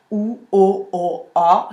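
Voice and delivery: "o, o" both the same, A falls back into the throat; two O's, wrong. Shown as a demonstration. Coach demos the mistake of two identical O's. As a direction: make both O's alike and lose the A to the back. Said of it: "si je fais deux O".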